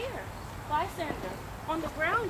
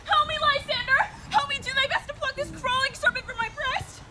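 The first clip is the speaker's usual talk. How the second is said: terrified cry for help; 7 semitones higher than usual. Acted. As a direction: scream for him out of a nightmare. Hermia wakes from nightmare.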